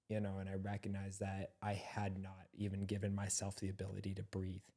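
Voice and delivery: ordinary talk, nothing marked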